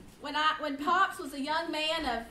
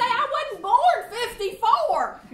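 laughing retort; 8 semitones higher, 10 dB louder than usual. Retold or imitated. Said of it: "I say".

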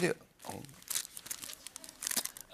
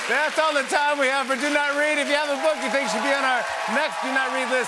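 higher, louder announcer sign-off; show sign-off; 11 semitones higher, 5 dB louder than usual. Real, not acted. Host closes the segment to the audience, big and loud. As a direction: wrap the segment big for the crowd.

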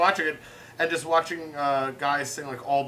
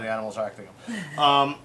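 ordinary talk

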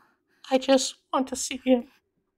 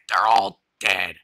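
dark deadpan; slower than usual. Acted. Deeper, slower.

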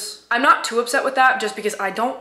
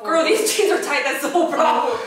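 laughing; 7 semitones higher than usual. Real, not acted. Complaining while stretching.

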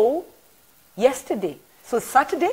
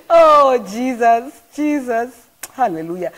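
loud, joyful cry of Jesus; high on the Spirit; 6 semitones higher, 11 dB louder, slower than usual. Real, not acted. Rejoicing in the Spirit after the broadcast, loud. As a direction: call out Jesus in delight. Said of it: "this is the mode that I'm in".

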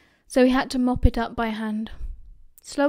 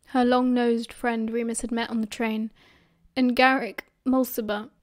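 usual speaking voice

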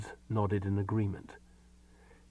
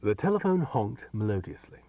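usual speaking voice

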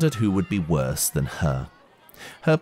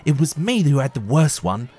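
higher, louder snapping retort; snapped back; 8 semitones higher, 5 dB louder than usual. Acted. Girl snapping back at the boy, louder and higher. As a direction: snap it back at him. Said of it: "Natalia snapped back".

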